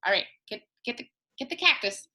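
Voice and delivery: plain reading voice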